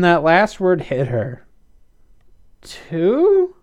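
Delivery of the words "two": high, rising question; intrigued query; curious. A narrator's reading. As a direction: ask it with pitch lifted right up. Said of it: "She looked at Nick, curious".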